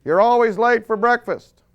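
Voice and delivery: high, loud nagging wife shriek; wife yelling at late husband, mock shriek. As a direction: shriek from the kitchen. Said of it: "she's shrieking from the kitchen".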